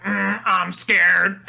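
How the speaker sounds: slow, drawn-out electrolarynx-style impression; Ned from South Park. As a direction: stretch it out in a buzzing monotone. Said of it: "just the way he says".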